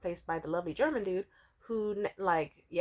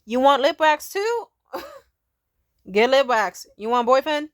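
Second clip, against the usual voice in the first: loud, high mock-accented salon voice; hammed-up Vietnamese accent, pushy and high; imitated salon lady; 7 semitones higher, 14 dB louder than usual. Salon lady pushing lip wax, loud imitated accent. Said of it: "and then saying".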